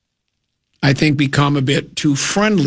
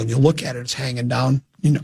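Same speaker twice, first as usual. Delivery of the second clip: ordinary talk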